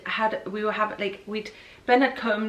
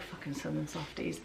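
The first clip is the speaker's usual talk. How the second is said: much softer, muttered aside; under-breath insult; 7 semitones lower, 17 dB quieter than usual. Retold or imitated. Thinking it in her head, muttered insult. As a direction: say it to yourself, barely aloud. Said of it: "in my head, I was like".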